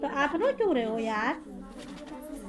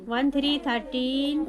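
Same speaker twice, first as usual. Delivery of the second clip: slow drill; 2 semitones lower, slower than usual. Shown as a demonstration. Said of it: "अब लिखो वन".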